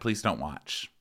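usual speaking voice

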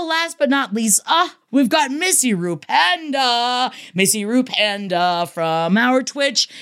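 ordinary delivery

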